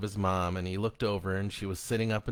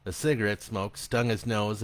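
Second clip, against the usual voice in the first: ordinary delivery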